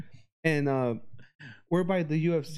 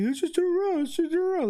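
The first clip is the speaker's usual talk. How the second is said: much higher mock worried pleading; panicking; 12 semitones higher than usual. Paranoid Tony begging friends to leave: high mock panic. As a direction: whine it like a paranoid stoner. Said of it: "he was just like".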